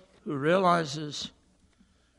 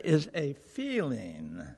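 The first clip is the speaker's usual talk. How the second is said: slow, drawn out "is a feeling"; "feeling" stretched and weighted; stress on feeling; 2 semitones lower, much slower than usual. Shown as a demonstration. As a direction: lean slowly into "feeling". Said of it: "I want to emphasize that word".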